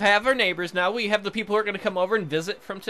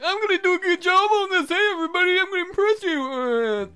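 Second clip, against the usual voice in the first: very high put-on voice; eager-to-impress character; 12 semitones higher, 3 dB louder than usual. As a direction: squeal it like an overeager show-off. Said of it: "uh, I'm like".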